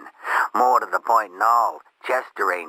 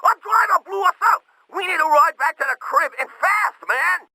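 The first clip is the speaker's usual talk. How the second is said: frantic high-pitched plea for a ride; urgent, scared, voice pushed up high; panicked caller; 4 dB louder than usual. Acted.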